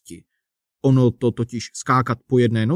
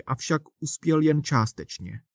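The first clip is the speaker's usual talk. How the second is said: usual speaking voice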